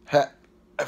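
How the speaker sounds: hiccup cuts the word; interrupted by a hiccup. Real, not acted. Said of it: "hiccuping every thirty seconds".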